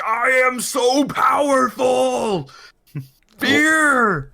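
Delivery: high, slow, booming boast; screaming. Acted. Ranting prisoner boasts to the guards, screaming. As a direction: stretch it into a grandiose holler. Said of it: "the guy's still screaming".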